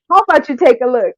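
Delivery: loud, fast and defiant, pitched high; exasperated shout of a dare, half laughing; loud fed-up challenge. Retold or imitated. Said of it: "this was really drastic".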